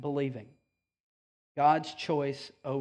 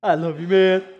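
a hint of a casual buddy, louder; mock buddy voice; 8 dB louder than usual. Imagined Jesus praising Peter, mock casual. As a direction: toss it off like a pal. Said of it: "Jesus doesn't say".

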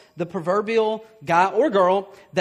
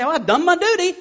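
smug mock giver; 10 semitones higher, 7 dB louder than usual. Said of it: "and say".

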